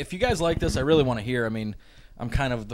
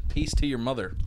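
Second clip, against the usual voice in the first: usual speaking voice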